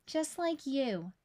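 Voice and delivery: put-on slow, gentle child voice; sweet child. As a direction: say it lovingly like a little girl. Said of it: "Emmy said".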